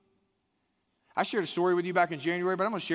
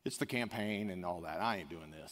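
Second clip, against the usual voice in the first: muttered refusal; 8 semitones lower, 8 dB quieter than usual. Retold or imitated. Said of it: "he was like".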